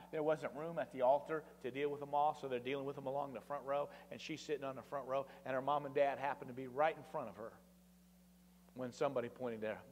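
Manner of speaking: ordinary delivery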